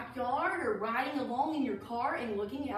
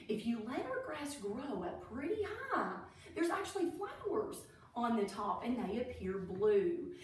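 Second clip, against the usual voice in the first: plain reading voice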